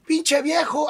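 imitated heckle, high and loud; heckler's shout. Audience member heckling the comedian onstage, mock shout. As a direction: call it out like someone in the crowd. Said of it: "algo le gritaban".

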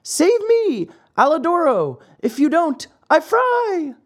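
very high, tiny puppet cry for help; small, desperate high-pitched wail; pitiful little voice. A narrator's reading. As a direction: plead in a squeaky little voice. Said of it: "a pitiful little voice was heard saying".

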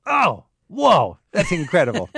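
high, loud amazed exclamation; playful imitation of awe; mock wonder. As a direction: marvel at the river. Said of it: "and go".

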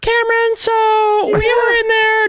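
very high falsetto mom voice; Cameron's mom. Retold or imitated. Host mocking a co-host's visiting mother, falsetto mom. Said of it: "his mom was like".

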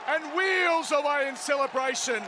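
shouting through laughter; laughing shout. Real, not acted. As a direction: laugh while calling the celebration. Victory celebration, laughing call.

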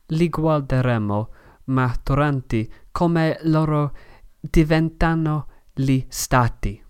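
ordinary talk, nothing marked

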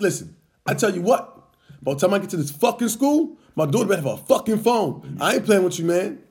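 retold angry phone threat.

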